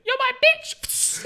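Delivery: put-on high, squeaky boy taunting; squeaky teen voice. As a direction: squeak it like a cocky sixteen-year-old. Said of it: "And I was like".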